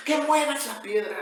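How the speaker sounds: loud, high, forceful command; angry shout. Acted. Angry Jesus insists to the sister at the tomb, angry shouted command. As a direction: shout the order, losing patience. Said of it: "recuerda que Jesús está enojado".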